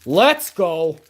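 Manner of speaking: excited shout. Real, not acted. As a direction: shout it in triumph.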